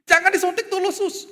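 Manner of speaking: high-pitched mock panic; panicky plea. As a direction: squeal at the nurse to hold off. Father pleads with the nurse.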